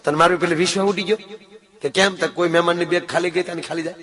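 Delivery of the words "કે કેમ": normal talking voice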